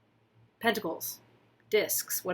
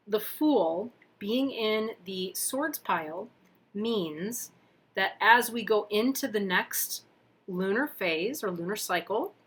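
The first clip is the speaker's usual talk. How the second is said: ordinary talk, nothing marked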